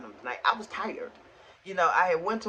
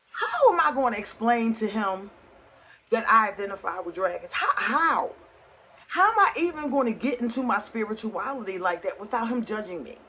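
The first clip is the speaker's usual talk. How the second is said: higher, louder, emphatic plea; exasperated pleading; 5 semitones higher, 4 dB louder than usual. Real, not acted. Asking viewers how to explain it to him, exasperated. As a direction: appeal to listeners in exasperated disbelief. Agonizing over confessing dragon identity.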